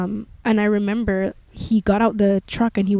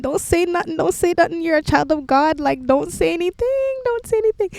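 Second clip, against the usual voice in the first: pleading with herself, climbing higher and higher; frantic self-pleading; 8 semitones higher than usual. Retold or imitated. To herself while a classmate taunts her, rising frantic plea. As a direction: beg yourself to hold it in, rising in pitch.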